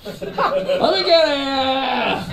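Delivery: high, hugely drawn-out New York holler; mock New Yorker order, very slow and high; New York accent. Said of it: "That's very New York".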